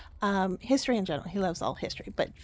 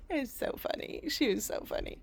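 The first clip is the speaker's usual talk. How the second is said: ordinary delivery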